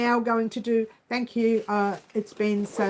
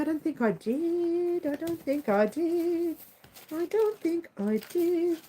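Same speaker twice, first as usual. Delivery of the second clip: higher, slow teasing singsong; higher playful chant; playful sing-song; 6 semitones higher, slower than usual. Pretend. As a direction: tease it out slowly, higher.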